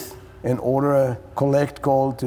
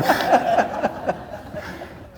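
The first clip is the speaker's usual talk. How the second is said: high, breathless trailing laugh; 12 semitones higher than usual. Real, not acted. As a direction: laugh it out after the punchline. Laughs after punchline.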